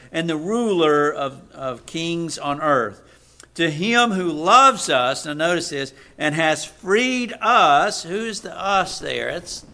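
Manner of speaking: loud, slow scripture proclamation with fervent asides; preaching at full force. Acted. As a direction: proclaim each phrase to the church. Reading Revelation with fervent asides.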